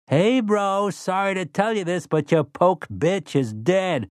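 high, slow gleeful taunt. Acted. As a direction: gloat over the bad news. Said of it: "He sounded gleeful".

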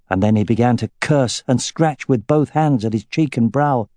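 ordinary delivery

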